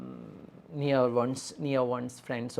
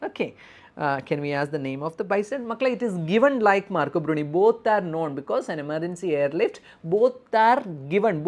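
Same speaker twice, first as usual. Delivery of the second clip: normal talking voice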